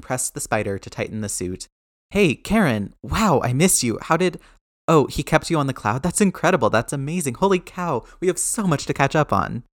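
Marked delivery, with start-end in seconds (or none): 2.1-9.6 high, bubbly, tumbling words; excited reunion. Acted. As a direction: gush like a kid meeting an old friend.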